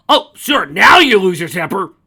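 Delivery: very loud, high screaming retort; furious scream. Acted. Mare screaming back at the dragon who grabbed her throat, furious. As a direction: scream it back in his face.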